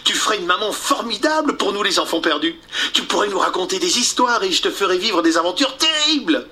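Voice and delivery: voix de gamin, plus aiguë, rapide; garçon fanfaron. Acted. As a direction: inviter avec enthousiasme. Peter invite Wendy. (Peter enthousiaste)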